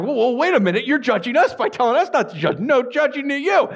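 high-pitched, rising protest; mock indignant retort. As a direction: object and throw it back, hammed up. Retold indignant argument over judging.

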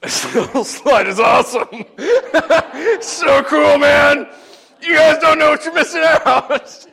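high, loud, laughing mock bravado; fake-cool teenager. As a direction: brag loudly to hide the fear. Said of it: "so I'd be like".

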